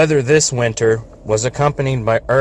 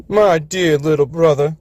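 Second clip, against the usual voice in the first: put-on higher, boastful older brother voice; big brother engine; 6 semitones higher than usual. A narrator's reading. Old engine Governor lecturing his little brother, patronizing. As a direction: talk down to your little brother. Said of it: "Governor told him one day".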